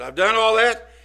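put-on boastful young man. Young man telling Jesus he did it all, proud. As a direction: throw it out proudly.

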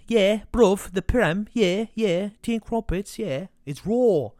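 put-on London-ish English accent, high and loud; hammed-up English bloke accent. Football podcaster trying an English accent on the Prem. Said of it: "my take at a English accent".

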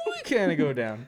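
high, loud squeaky replay; exaggerated rise through a laugh; voice gone up. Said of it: "how come your voice went up?".

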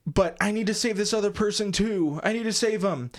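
high mock-earnest pleading; Harry Potter. Host voicing the hero underwater, high earnest mockery.

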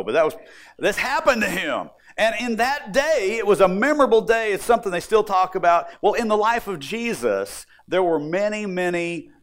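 usual speaking voice

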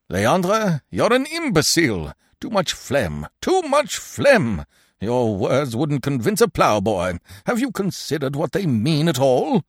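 blustering, indignant rebuke; angry. A narrator's reading. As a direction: berate a hopeless pupil, pitch jumping up. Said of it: "There was no mistaking his anger".

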